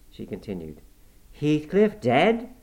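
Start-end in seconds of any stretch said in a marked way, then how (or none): 1.3-2.5 slow, louder, stunned disbelief; astonished exclaim. A narrator's reading. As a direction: let the news land in shock. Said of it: "I exclaimed, astonished".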